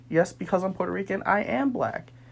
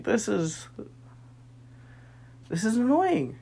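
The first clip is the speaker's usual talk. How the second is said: normal talking voice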